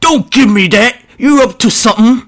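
high, loud orc bellow; accusing orc shout. Acted. Orc boss not believing the grots, angry shout. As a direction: bark the accusation at the runts.

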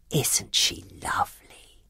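slow, soft whisper. Acted. Missus Potts whispers to the others about Belle, soft whisper. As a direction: whisper it so Belle won't hear. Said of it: "whispered Missus Potts".

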